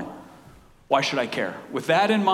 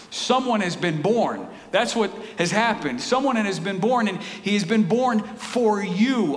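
ordinary talk

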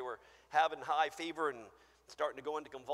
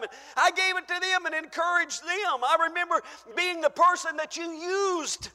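higher, louder, building to a cry; fervent believer; 12 semitones higher, 10 dB louder than usual. Acted. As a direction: list your gifts to God, urgent. Acted plea at judgment.

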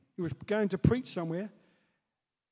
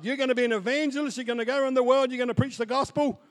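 raised, fast declaration; proclaiming prophecy; 6 semitones higher, 7 dB louder, faster than usual. Retold or imitated. Wigglesworth prophesies over a man, raised fast proclamation. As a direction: announce his destiny with fire.

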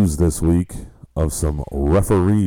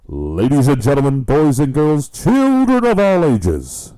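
ringmaster; 9 semitones higher, 5 dB louder than usual. Acted. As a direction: announce it under the big top. Circus-style opening call to listeners.

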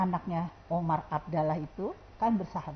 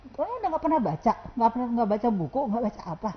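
fast, high, louder mock tirade; rapid scolding; 3 semitones higher, 3 dB louder, faster than usual. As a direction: rattle off the scolding like a grumpy mentor. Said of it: "Dibilang tolol-tolol melulu". (omelan cepat yang ditirukan, tinggi dan keras)

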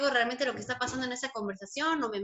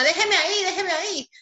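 yelling out the window; 7 semitones higher than usual. Retold or imitated. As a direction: shout it through a window at the delivery man. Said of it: "le gritaba por la ventana". (gritando por la ventana)

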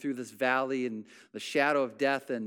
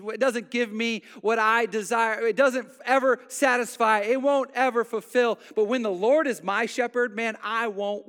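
loud, higher, driving pulpit preaching; preaching at full force; 8 semitones higher, 7 dB louder than usual. Acted. Pastor rallying the congregation, loud full-force preaching. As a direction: build it up and hammer every line home.

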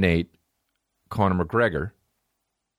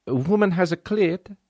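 put-on Italian accent; 10 semitones higher than usual. Italian voice in quoted dialogue.

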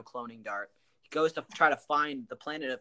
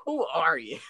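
sharp, higher demand; mock indignant challenge; 4 semitones higher, 4 dB louder than usual. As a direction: confront her, offended. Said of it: "He's just like".

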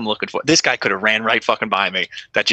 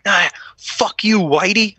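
hostile victim; 9 semitones higher, slower than usual. Retold or imitated. Wounded girl snapping at the cop.

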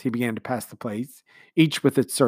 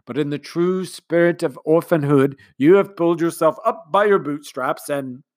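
commander's speech; 4 semitones higher, 7 dB louder than usual. A narrator's reading. Commander speechifying to the household, sentimental. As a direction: speak like a sentimental host.

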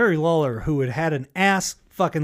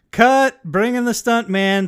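loud, higher shout of cut; director yelling cut; 8 semitones higher, 7 dB louder, slower than usual. Retold or imitated. Director stopping the take, shouted command. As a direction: yell it across the set. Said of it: "And then he'd go".

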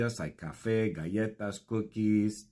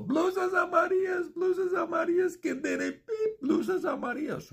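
very high shrill street shout; shouting vendor. Retold or imitated. Norma on Las Vegas Boulevard, screaming about yellow blouses.

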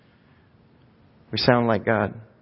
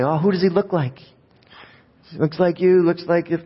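higher, gushing over a newborn; cooing relatives; 6 semitones higher than usual. Retold or imitated. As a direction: mimic relatives fussing over a baby. Imitating relatives cooing over a baby.

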